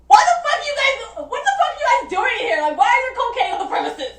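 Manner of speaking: high-pitched, incredulous rant; hammed-up indignant questioning; mock outrage. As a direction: scold them in a squeaky, half-laughing voice. Imagined telling-off of the host's friends.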